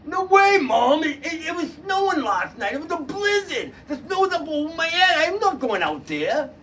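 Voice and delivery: lightly voiced whiny boy refusing; stubborn son. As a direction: dig in like a stubborn teen. Son refuses his mother.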